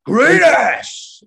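high, loud shouted impression; Pacino yell. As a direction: belt it out like Pacino. Quoting Pacino's famous yell.

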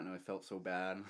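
normal talking voice

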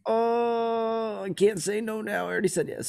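mock dismay. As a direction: whine like someone who is stuck now. Retold dismay at the price.

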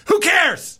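high, offhand "who cares" tossed out; therapist's dismissive shrug. Retold or imitated. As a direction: brush it off like the therapist did. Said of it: "he goes".

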